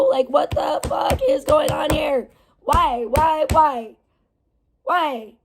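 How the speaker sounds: strained, pleading why why why; exasperated outburst. Real, not acted. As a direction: vent full frustration. Ranting about outrage double standards.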